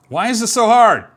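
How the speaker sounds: loud, high exasperated cry; frustrated outburst. Real, not acted. Coder stuck on a bug yells, frustrated and loud. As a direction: let the frustration burst out at the screen. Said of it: "getting frustrated".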